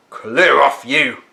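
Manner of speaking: loud, rough man barking from behind; big gardener shouting at a boy near the tree; gruff gardener. Acted. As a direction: chase the kids away. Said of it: "said a deep voice".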